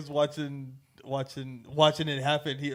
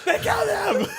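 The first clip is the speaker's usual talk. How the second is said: mock wail. Actor calls out to his mom in a high laughing wail. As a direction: wail it like a betrayed kid. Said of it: "And he was like".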